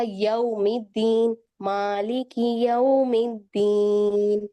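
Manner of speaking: slow drawn-out Arabic recitation, yaumiddeen with clear au; Quran recitation. Shown as a demonstration. Tajweed teacher demonstrating Arabic recitation, slow and drawn. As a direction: recite slowly, stretching यौमिद्दीन with a round au. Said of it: "जैसे हमने सूरत अल-फातिहा में".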